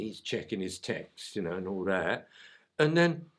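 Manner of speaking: normal talking voice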